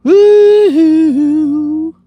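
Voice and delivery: loud, high, drawn-out woo-hoo; long whoop. Real, not acted. Whooping after the squats burn, long loud whoop.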